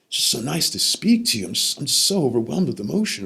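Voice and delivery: high, imitated emotional voice; retold overwhelm. Grandfather glad to speak to grandson, retold overwhelm. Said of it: "He's overwhelmed".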